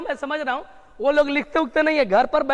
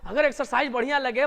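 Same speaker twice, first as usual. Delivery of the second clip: ordinary talk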